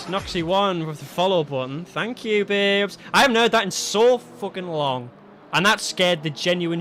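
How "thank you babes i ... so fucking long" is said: ordinary delivery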